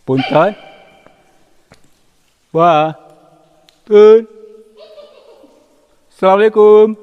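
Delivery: high, loud hail into the distance; loud calling out. Real, not acted. Explorer hailing someone unseen in a cave, loud calling. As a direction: call out for whoever may be there. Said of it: "intonasi bicaranya mungkin terlalu kenceng".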